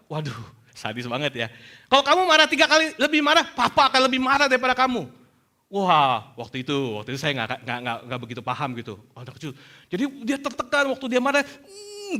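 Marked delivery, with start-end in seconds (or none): 1.9-5.0 louder, higher mock-stern father; acted angry threat. Father warning his angry youngest child, loud acted threat. 11.5-12.2 high, soft pent-up growl-hum; imitated angry child's strained "hmm". Father imitating his stressed youngest when angry, high mock hum. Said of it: "dia gini kayak marahnya".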